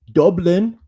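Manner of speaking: a put-on Irish lilt on Dublin, pitched high and loud; bad Irish accent. College football vlogger hams up a quick Irish accent on Dublin. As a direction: say Dublin the way a hammed-up Irishman would. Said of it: "That's a terrible accent".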